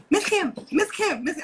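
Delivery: high, loud repeated calling of the name; nagging child calling. Retold or imitated. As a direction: pester her like a kid who won't stop. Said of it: "Like, don't".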